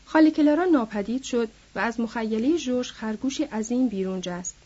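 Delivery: plain reading voice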